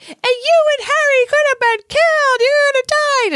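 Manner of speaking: high, loud screeching scolding; full-blast high-pitched telling-off; yelling howler letter. Retold or imitated. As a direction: shriek it like a furious mom's letter. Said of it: "terrible letter that yells at him".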